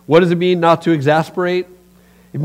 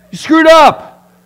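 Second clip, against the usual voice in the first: yelling parents; 10 semitones higher, 10 dB louder than usual. Retold or imitated. Hockey parent yelling at his kid on the bench, loud and angry. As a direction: bark at the kid from behind the bench.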